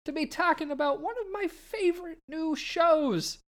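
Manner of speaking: bright, loud show-host welcome. Acted. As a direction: welcome the listeners like a radio host.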